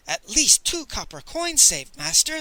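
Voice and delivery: loud, high, eager exclamation; excited cry. A narrator's reading. Cook to his master over coins saved, crying out. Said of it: "the cook cried".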